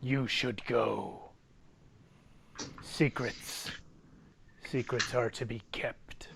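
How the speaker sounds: slow, soft, whispered warning; hushed warning. Acted. Game master as Jack sending the party away, hushed and ominous. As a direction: breathe each phrase out quietly, with pauses.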